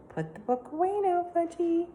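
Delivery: sing-song mom voice; mother to toddler. A narrator's reading.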